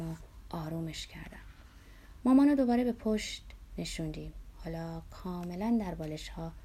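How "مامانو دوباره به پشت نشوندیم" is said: usual speaking voice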